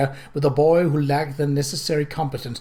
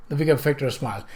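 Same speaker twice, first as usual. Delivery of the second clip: ordinary delivery, nothing marked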